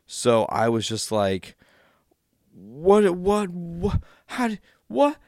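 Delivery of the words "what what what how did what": stunned disbelief. Retold or imitated. Shocked at Darth Maul's return.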